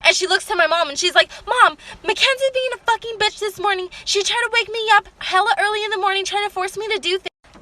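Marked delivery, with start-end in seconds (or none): 1.5-7.6 high-pitched tattling sister imitation; raised-pitch mock rant; mock yelling. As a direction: mimic her complaint without real volume. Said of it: "she's yelling at us".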